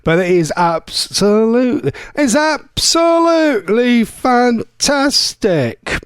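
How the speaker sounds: mimicking a comic impression, very drawn out; copied impressionist catchphrase. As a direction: draw out each syllable like the impression. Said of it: "If you ever hear me say that".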